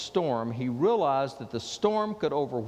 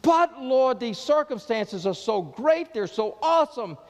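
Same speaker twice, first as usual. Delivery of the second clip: pleading, louder anguished prayer; despairing plea; 7 semitones higher, 4 dB louder than usual. Retold or imitated. Believer pleading with the Lord, a mild anguished plea. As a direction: cry out overwhelmed to the Lord. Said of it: "you come up with those words".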